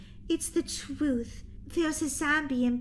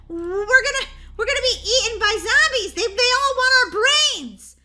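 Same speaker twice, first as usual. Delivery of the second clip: higher, louder frightened rush; panicked; 7 semitones higher, 7 dB louder than usual. Acted. Sister warning about being eaten, loud panic. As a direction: sound scared out of your wits.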